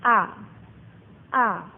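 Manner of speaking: short A with no emphasis; brief A dropped without any stress; light neutral tone. Shown as a demonstration. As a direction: say A briefly, no tone pressed. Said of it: "yang terakhir cingseng nada ringan".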